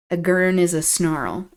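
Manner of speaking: ordinary delivery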